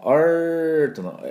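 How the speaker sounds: long, held R sound in アート; drawn-out English R. Shown as a demonstration. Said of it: "アールの音はですね".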